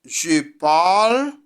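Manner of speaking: "Je parle" said carefully, with a rise on "parle"; teacher modelling "Je parle"; French model. Shown as a demonstration. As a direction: model the French form for students. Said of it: "voy a decir je parle".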